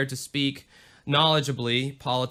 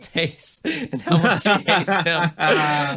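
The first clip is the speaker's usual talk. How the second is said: breaking into laughter mid-line, slowed down; slow words pulled apart by a real laugh; laughing while reading; 5 dB louder, much slower than usual. Real, not acted. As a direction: read it through giggles. Reading a review about herself.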